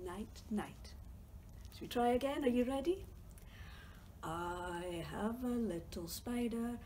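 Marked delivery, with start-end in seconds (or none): none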